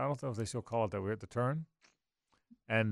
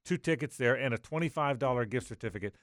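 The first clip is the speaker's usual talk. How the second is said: radio announcer call-out; 3 semitones higher, 5 dB louder than usual. Acted. Radio giveaway call-out to listeners.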